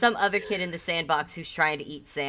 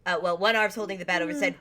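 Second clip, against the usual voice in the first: usual speaking voice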